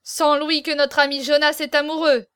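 loud, teasing cry; exclaiming. A narrator's reading. A courtier bets before the laughing company, exclaiming. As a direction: call out the wager to everyone. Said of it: "s'écria Navaille".

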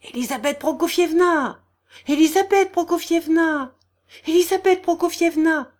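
high, loud cries of protest; shocked exclamations. A narrator's reading. Onlookers crying out to restrain her, alarmed. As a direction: call out her name to stop her. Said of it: "se firent entendre des exclamations".

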